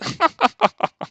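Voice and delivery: high, haughty aristocrat's laugh; smug lordly chuckle. Retold or imitated. The British lord laughing at his opponent, smug chuckle. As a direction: chuckle like a lord amused by a peasant. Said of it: "that's my impression".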